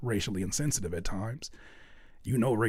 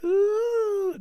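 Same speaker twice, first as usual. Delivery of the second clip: high, teasing sitcom-crowd ooh; mock audience "ooh"; 5 dB louder than usual. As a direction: coo it like an audience at a TV kiss. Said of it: "when they start kissing".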